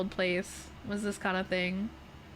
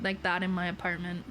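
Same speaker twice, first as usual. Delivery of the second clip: usual speaking voice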